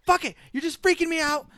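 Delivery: imitating someone flustered and fed up; retold freak-out. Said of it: "and she goes".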